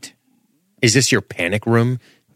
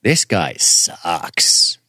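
disgusted drawl; 3 semitones lower, much slower than usual. Real, not acted. Disgusted comment on character.

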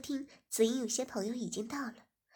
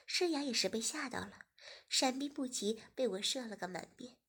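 ordinary delivery, nothing marked

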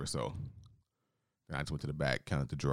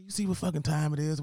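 mock rude customer; 11 semitones higher than usual. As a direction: snap like a cocky jerk.

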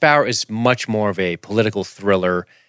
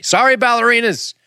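mock apology; 13 semitones higher than usual. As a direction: sing out a jokey apology to the dancers.

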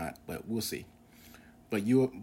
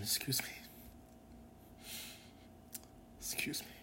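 whispered, composing yourself after tears; choked whisper; 5 semitones higher, 12 dB quieter than usual. Real, not acted. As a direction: breathe it out softly, pulling yourself together. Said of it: "getting a little emotional right now".

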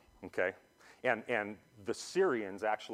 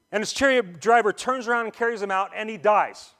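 higher, dramatic Bible reading; scripture reading; 10 semitones higher, 7 dB louder than usual. Acted. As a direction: read the verse building drama. Reads the verse aloud.

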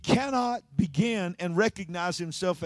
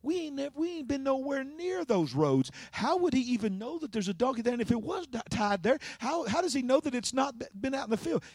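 higher-pitched voice of baffled disciples; puzzled disciples; 7 semitones higher, 4 dB quieter than usual. Acted. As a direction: voice their doubting thoughts, pitched up. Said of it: "the whole time they're thinking".

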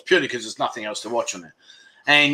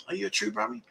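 a light Brummie accent, softer, rising on Brummie; mock Brummie accent; 5 semitones higher, 12 dB quieter, faster than usual. Shown as a demonstration. Host teases a Birmingham viewer in a mock Brummie accent. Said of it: "What an accent they've got".

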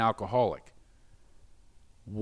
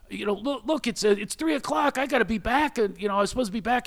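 high-pitched, hurried protest, re-enacted; retold pleading protest; 9 semitones higher than usual. Caller insisting he must be back, in high retold protest. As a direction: plead your case in a raised pitch. Retold phone argument about time.

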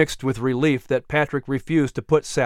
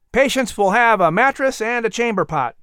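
put-on higher, eager young man's voice; a helpful workman greeting, pitched up; young worker; 7 semitones higher than usual.

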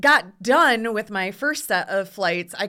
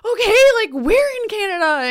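excited; 12 semitones higher, 6 dB louder than usual. Retold or imitated. Mom reacting to big news.

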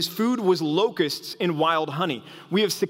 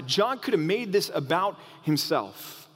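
normal talking voice

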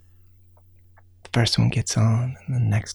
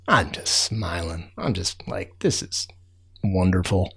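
soft content; 6 semitones lower, 7 dB quieter than usual. Acted. Narrator at the bottom of the hill, softly content. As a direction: hush it with a smile.

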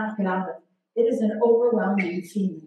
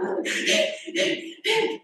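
breathless laugh; 11 semitones higher than usual. Real, not acted. Laughing at own story.

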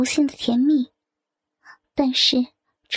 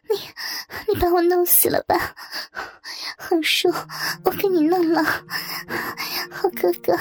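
gasping, higher-pitched character voice; out of breath; 4 semitones higher than usual. A narrator's reading. Woman crying out to her lover, breathless. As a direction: break the phrases up as if breathless. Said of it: "只见他喘不过气来似的".

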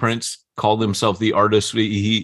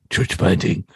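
bad sensei impression, stopping before the verb; lightly voiced film sensei giving a maxim; Mister Miyagi; slower than usual. Overlaying church planting on the Karate Kid quote, bad Miyagi impression. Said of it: "does my voice sound like Mister Miyagi's".

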